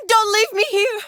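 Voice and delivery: high, loud panicked plea; desperate cry. Acted. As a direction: cry out in alarm to be heard. Pleading louder, desperate.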